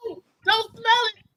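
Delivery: high-pitched panicked plea, acted out; retold frantic begging, voice pitched high; mock panic. Woman retelling pleading with boyfriend, mock panic.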